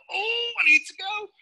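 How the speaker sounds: high, drawn-out "oh, I need to go"; hammed-up high-pitched toilet plea; mock desperate urge. Second way of needing the toilet.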